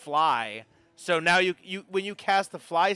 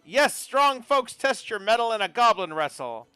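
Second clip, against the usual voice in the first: loud, higher barker's pitch; carnival barker; 6 semitones higher, 5 dB louder than usual. Acted. Barker invites strong folks to wrestle, carnival barker. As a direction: call out to draw challengers in. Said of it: "the Barker says".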